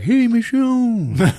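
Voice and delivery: high, long drawn-out hello; gleeful mock greeting. Fan greeting a familiar actress on screen, high drawn-out mock greeting. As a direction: sing it out high and slow. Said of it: "but I was like".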